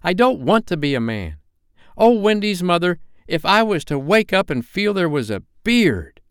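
put-on high, impassioned boy's protest; passionately. A narrator's reading. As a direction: plead in horror at growing up. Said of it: "he told her passionately".

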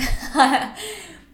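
bright laugh bursting out; high burst of laughter. Real, not acted. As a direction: laugh out loud at yourself. Laughing at her own filler words.

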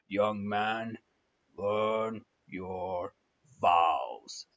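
very low, deliberate command with pauses; stern pastor. Retold or imitated. Pastor pointing at the groom, low stern order. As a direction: drop deep and space out the order. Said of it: "and he says".

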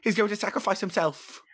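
high, alarmed British delivery; British accent. Acted. Harry telling Hermione of Ron's plan, high British alarm. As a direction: realise it aloud in a British accent. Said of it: "what you thought of our accents".

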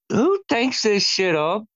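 high, slow, disbelieving; drawn-out who-does-this disbelief; incredulous. Real, not acted. Incredulous at silly plot.

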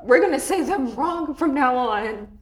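slow, exaggerated proclamation; mock declaration. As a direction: announce it like a rebel plan. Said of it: "I was like".

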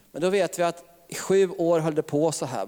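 ordinary talk, nothing marked

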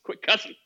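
"cussing" said as cussin', with no G; dropped G. Shown as a demonstration. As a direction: drop the G on "cussing". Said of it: "You take off the G".

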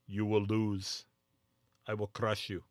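a light, low-key Russian boxer menace; a hint of a deadpan movie villain vowing to win; Dolph Lundgren in Rocky IV. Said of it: "it's almost like Dolph Lundgren".

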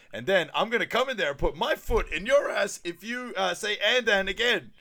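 high, loud ranting customer; acted angry outburst; mock-furious threat. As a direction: build an exasperated threat, hitting each word.

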